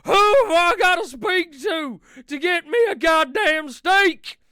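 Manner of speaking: loud, high, furious demand; red-faced outlaw shouting at the waiter; Dutch yelling. Acted. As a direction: bellow for a steak like an outraged cowboy. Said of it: "Dutch, will you stop making a scene?".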